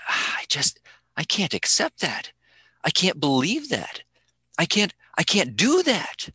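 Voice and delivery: lightly acted reluctant believer; doubter voiced. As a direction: mimic a hesitant skeptic. Voicing doubters who reject Jesus.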